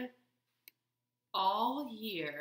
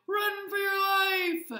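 high, loud, drawn-out shout; shouting rabbit; 10 semitones higher, 8 dB louder, slower than usual. A narrator's reading. Rabbit tells everyone to flee, high drawn-out shout. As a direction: shout a long warning to all. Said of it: "shouted a rabbit".

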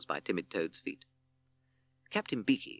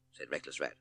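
ordinary delivery

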